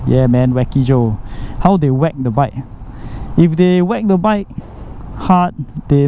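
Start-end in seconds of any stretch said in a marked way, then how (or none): none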